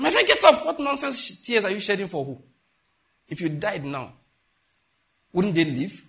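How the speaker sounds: loud, high-pitched telling-off; acted scolding. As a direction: burst in loud, rebuke the crier, then ease down. Acted rebuke of his crying.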